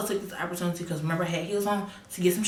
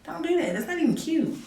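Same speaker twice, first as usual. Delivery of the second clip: high indignant scolding; 10 semitones higher than usual. Retold or imitated. Scolding friend's tight outfit.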